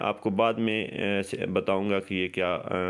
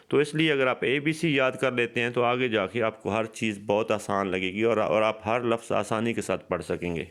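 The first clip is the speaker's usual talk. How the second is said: normal talking voice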